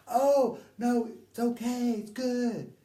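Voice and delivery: high, loud yelled reply; reenacted reassuring shout. Teller answers worried companion under waterfall, reenacted high shout. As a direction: holler reassurance over roaring water.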